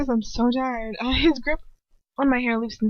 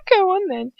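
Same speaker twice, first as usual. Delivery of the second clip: taunting scream; 8 semitones higher, 4 dB louder than usual. A narrator's reading. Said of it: "Bellatrix screams".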